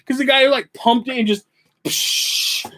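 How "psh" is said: breathy psh of a water blast; splash noise. Retold or imitated. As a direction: voice the blaster hitting. Mimicking water blaster hit.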